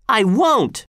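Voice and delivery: stout refusal. A narrator's reading. Boy refusing to kneel to the governor, mildly defiant. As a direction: refuse with a touch of pluck. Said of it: "said Walter stoutly".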